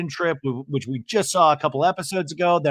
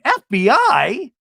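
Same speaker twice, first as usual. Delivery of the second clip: high, loud, slow mock disbelief; hammed-up stunned question; shocked Jen; 8 semitones higher, 6 dB louder, slower than usual. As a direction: drag out the shock. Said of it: "And Jen going".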